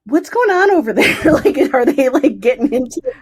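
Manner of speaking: high, loud, laughing through the question. Retold or imitated. Calling Jared on New Year's, laughing. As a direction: giggle while asking.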